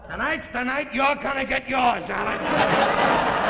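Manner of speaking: high, loud, building threat; angry threat. Acted. Husband threatens wife, loud and angry. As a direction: build to a roar on the name.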